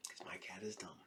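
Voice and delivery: a quick whispered aside. Real, not acted. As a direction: breathe it out under your breath. Aside about his goofy cat.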